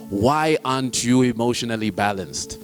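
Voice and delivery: normal talking voice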